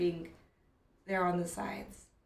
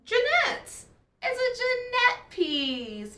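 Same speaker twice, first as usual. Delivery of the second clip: high, loud delighted cry; excited; 13 semitones higher, 7 dB louder, slower than usual. Real, not acted. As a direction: shout the name with joy. Excited recognition of artist.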